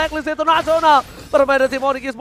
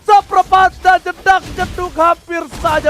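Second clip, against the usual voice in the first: excited shout; 5 semitones higher, 4 dB louder, slower than usual. Real, not acted. Caster calling a near kill.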